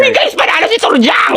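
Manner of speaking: high, jubilant announcement; excited cheer. Acted. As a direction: cry out the victory to friends. Classmate cheers the quiz win. (tuwang-tuwang sigaw)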